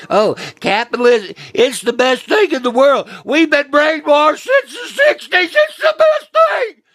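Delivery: high, loud mocking voice climbing to a shrieking shout; mock capitalism fan. Mocking people who scream about capitalism, rising to a shout. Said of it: "y'all scream about that".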